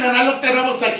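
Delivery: ordinary talk, nothing marked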